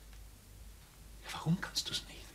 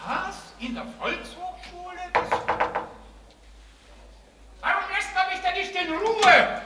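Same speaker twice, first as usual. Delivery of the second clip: agitated outburst; 6 dB louder than usual. Acted. Comedian flustered before going on.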